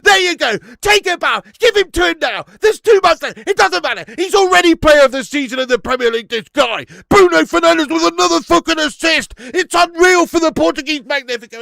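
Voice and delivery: loud, high, jubilant yelling; goal celebration shout. Real, not acted. Streamer celebrating the assist to chat, roaring. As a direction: roar it like your team just scored.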